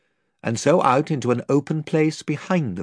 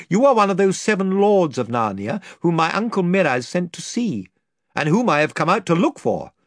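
higher, louder kingly reveal; royal declaration; 8 semitones higher, 3 dB louder than usual. Acted. Caspian revealing to the lord he is king, bold. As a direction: claim the throne boldly.

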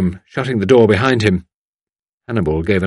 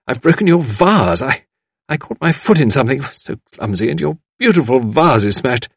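higher, flustered apologetic outpouring; dismayed apology; 5 semitones higher, 4 dB louder than usual. A narrator's reading. Visitor apologising for the broken vase, dismayed. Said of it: "an exclamation of dismay".

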